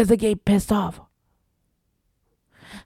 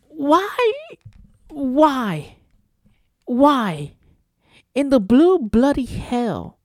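drawn-out outraged why; 4 semitones higher, much slower than usual. Real, not acted. Ranting podcaster drawing out outrage.

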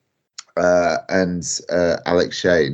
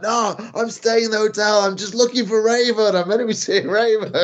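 high-pitched drunken protest, imitated; hammed-up drunk, much higher voice; Sandman; 12 semitones higher than usual. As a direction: mimic a drunk pleading with hotel staff. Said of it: "you can hear Sandman going".

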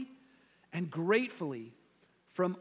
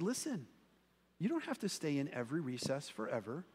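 softer, gentle questioning; calm, loving; 2 semitones lower, 5 dB quieter than usual. Retold or imitated. Teacher kindly reasoning with an upset boy, soft and gentle. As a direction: coax him kindly out of the tantrum. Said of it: "she just calmly and lovingly".

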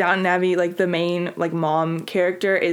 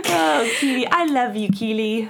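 high-pitched pretend British farewell; hammed-up British accent; mock British accent; 4 semitones higher than usual. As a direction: say goodbye in a put-on British accent. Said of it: "I can't do this".

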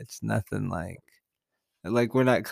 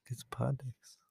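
whisper; 11 dB quieter than usual. Pretend. Mock ad plug, whispered.

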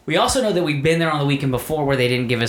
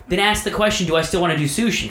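raised-pitch, fed-up retelling; exasperated retort; 7 semitones higher than usual. Retold or imitated. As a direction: argue back in mild exasperation. Acting out a quarrel over lunch.

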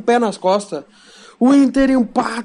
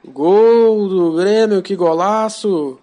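explosive goal commentary; much slower than usual. Acted. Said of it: "sua narração".

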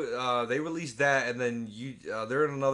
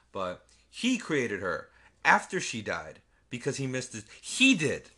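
HE punched hard, with slowed delivery; heavy emphasis; slower than usual. Real, not acted. Stressing who cloned the daughter, heavy emphasis. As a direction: lean slowly on "he", landing hard on "He did". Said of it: "I'm emphasizing this".

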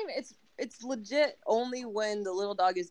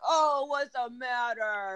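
slow, higher, doting coo; cooing to the dog; 6 semitones higher, much slower than usual. Retold or imitated. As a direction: coo over the pet like a baby. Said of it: "she'll just be like".

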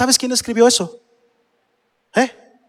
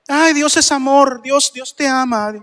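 slow, syrupy parody of loving piety; mock sweetness; 3 semitones higher, slower than usual. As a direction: coo it slowly, mocking the believers. Mocks the love-only doctrine. (dulzura burlona y lenta)

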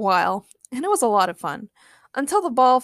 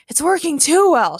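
high, louder alarmed shout; raised voice, leaning on "too"; 6 semitones higher, 6 dB louder than usual. A narrator's reading. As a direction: yell back in alarm. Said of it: "shouted Ray".

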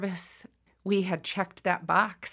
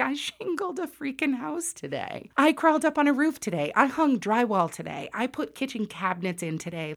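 high-pitched list of proud accomplishments; retold youthful one-upmanship, pitched up; proud boasting; 6 semitones higher than usual. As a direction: brag about each job in turn. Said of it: "sense of pride".

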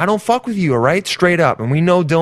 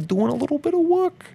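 higher, softer silly put-on voice; odd put-on voice; 13 semitones higher, 4 dB quieter than usual. Podcaster nags listeners in a silly higher voice. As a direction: pitch it up and hush it, committing to the bit. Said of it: "why am I talking like this? I don't really know, but I'm committing to it".

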